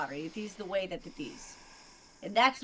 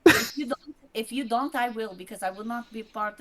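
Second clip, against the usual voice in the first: angry; 4 semitones higher, 10 dB louder than usual. Real, not acted. Heated argument continues.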